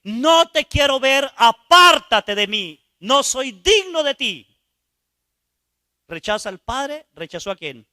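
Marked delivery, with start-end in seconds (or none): 0.0-4.5 high, loud and slow, each phrase flung out; a man yelling at the one he feels unworthy of; shouted rejection. Retold or imitated. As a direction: scream that you are not worthy. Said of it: "le grité".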